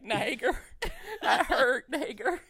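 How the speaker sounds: high, loud mock-pained call. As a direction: call out his name, hammed-up hurt. Acting himself hurt, still calling name.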